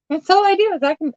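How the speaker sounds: words tumbling out through a giggle, high and quick; laughing. Real, not acted. Laughing at herself while sorting the box, giggling. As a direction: laugh right through the line. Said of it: "don't know why I'm laughing".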